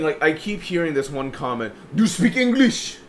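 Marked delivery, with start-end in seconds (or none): 1.9-3.0 put-on high-pitched, demanding Egyptian-accented voice; mock an irate customer with an Egyptian accent. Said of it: "just a general Egyptian accent".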